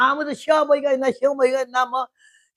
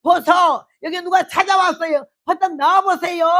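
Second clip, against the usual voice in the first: loud yell, higher, calling across the house; brash wife's call at full volume; yelling for husband; 7 semitones higher, 6 dB louder than usual. Retold or imitated. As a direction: bellow for the husband from the door. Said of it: "근데 왜 이렇게 소리 질러?".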